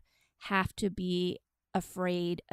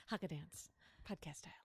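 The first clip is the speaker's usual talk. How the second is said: whispered aside; 18 dB quieter, faster than usual. Real, not acted.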